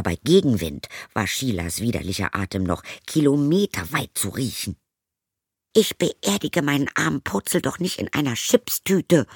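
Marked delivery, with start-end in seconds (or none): 5.7-9.3 higher, indignant retort; snapping at her. Acted. Zoe snaps at her stepmother, outraged. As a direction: bite back, outraged. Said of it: "fuhr Zoe sie an".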